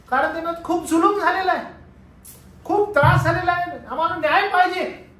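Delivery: high, loud, urgent appeal; impassioned preaching. Acted. Preacher voices saints demanding justice, loud and urgent. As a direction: press the injustice hard.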